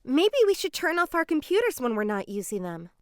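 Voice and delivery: ordinary delivery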